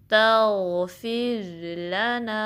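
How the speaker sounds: very slow drawn-out chant; slow tajweed recitation; Quranic recitation. Shown as a demonstration. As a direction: recite it slowly, stretching the vowels. Recites the word slowly.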